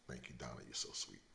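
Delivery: put-on soft, voiceless thank-you to his girl; whispered aside. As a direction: breathe it as a private aside. Aside thanking girlfriend Donna.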